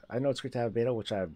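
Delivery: ordinary delivery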